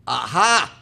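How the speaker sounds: loud, pouncing exclamation; gotcha. Acted. Angel catching out the woman at heaven's gate, triumphantly. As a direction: pounce on the admission.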